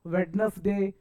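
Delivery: वेडनेसडे in three parts, "wed-nes-day"; mispronunciation. Shown as a demonstration. Teacher mimicking many students, वेडनेसडे as "wed-nes-day". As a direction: sound the first d and middle vowel of वेडनेसडे. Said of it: "बहुत बच्चे क्या बोलते हैं".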